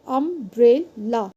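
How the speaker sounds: "umbrella" slowly, each syllable on its own; split into syllables. Shown as a demonstration. Teacher repeating the breakdown for students, "umbrella" in separate syllables. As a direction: pause between the parts of "umbrella". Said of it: "word को तोड़ के देखेंगे".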